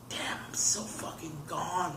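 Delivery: slow, hushed disbelief; stunned whisper. Retold or imitated. Man recalls being overwhelmed by the movie on acid, stunned whisper. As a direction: whisper it slowly, as if awed at yourself.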